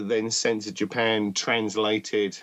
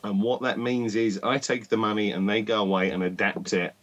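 ordinary talk, nothing marked

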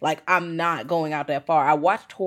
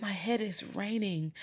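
dazed mumble; 4 semitones higher, 11 dB quieter than usual. Retold or imitated. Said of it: "He's like".

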